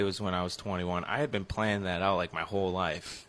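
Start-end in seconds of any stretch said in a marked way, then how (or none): none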